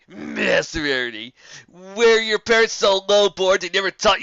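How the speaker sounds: loud, mocking griffin bully; swaggering insult, played big to an audience; griffin taunt. A narrator's reading. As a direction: sneer it at the crowd like a brash bully. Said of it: "launching into his first insults".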